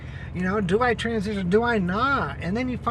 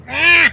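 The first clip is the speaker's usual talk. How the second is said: loud high frustrated shout; a sharp yelp when the mic fails; 6 semitones higher, 14 dB louder than usual. Real, not acted. Gadget not working.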